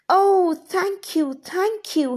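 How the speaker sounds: high, slow, grateful little gnome voice; grateful gnome crying out. Acted. Gnome thanking Wilma for untying him, high and slow. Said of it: "he cried".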